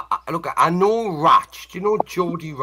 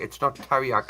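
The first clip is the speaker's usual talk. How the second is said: normal talking voice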